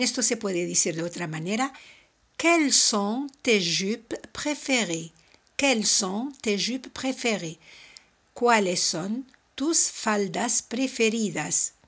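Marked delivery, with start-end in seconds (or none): 2.4-5.1 slow, careful French model question. Shown as a demonstration. Teacher modelling a French question slowly for students. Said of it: "teacher models French question". 8.3-11.7 slow, clear model sentence; slow careful translation. Shown as a demonstration. Teacher slowly translating the question for students. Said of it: "teacher models Spanish translation".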